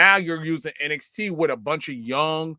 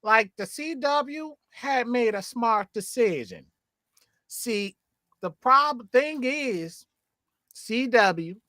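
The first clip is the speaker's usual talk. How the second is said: put-on manager voice, pitched up; managery voice; 6 semitones higher than usual. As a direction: make the case in a higher, affected manager's voice. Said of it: "I'm doing my ... managery voice".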